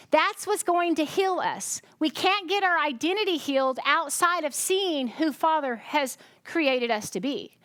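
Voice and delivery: normal talking voice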